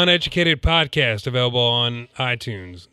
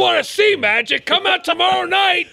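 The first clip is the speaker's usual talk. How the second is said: high, loud promo hype; wrestling promo; 4 dB louder than usual. Pretend. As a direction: sell the show like a wrestler.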